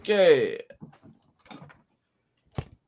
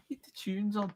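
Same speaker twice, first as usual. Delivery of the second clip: higher, much quieter Scouse echo; soft mock Scouse; 7 semitones higher, 10 dB quieter than usual. Retelling the guy at the back, hushed mock Scouse. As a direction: repeat it small in a put-on Liverpool voice. Softer repeat of the Scouse quote.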